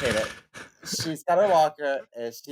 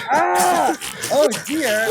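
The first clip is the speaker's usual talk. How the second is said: high squealing laugh through the words; 11 dB louder, much slower than usual. Retold or imitated. Telling the podcast host about mom's reaction, squealing with laughter. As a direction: barely get it out for laughing. Mom echoing grandma, laughing.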